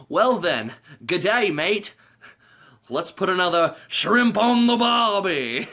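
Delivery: put-on goofy Australian accent, building to a drawn-out shout; mock Australian accent. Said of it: "That's a lovely accent you have".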